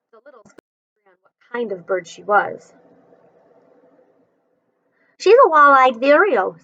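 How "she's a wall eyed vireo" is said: lightly voiced know-it-all boy, louder; scientific. Boy naming the bird, lightly voiced with scientific confidence. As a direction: say it like a little expert. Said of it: "said George scientifically".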